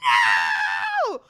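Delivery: high, loud wailing cry; anguished no. Retold or imitated. The boyfriend seeing the bed scene on TV, a high loud cry. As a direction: wail it in despair. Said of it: "this man going".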